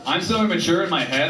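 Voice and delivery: ordinary talk, nothing marked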